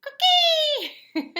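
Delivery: shrill loud frog cry, laughing; laughing frog imitation; frog call. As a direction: squeal it like the frog. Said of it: "It's like".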